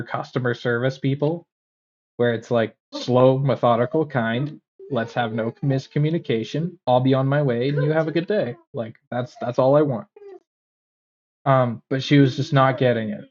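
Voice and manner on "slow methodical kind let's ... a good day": ordinary talk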